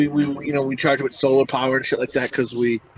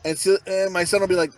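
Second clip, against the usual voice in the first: ordinary delivery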